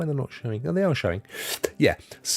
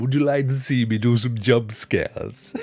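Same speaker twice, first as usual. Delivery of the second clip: put-on drawn-out comic accent as a streamer's welcome; playful overdub voice with a put-on accent; mock accent for Ray; 3 dB louder than usual. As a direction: ham up the accent, slow and stretched. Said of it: "if Ray had that accent".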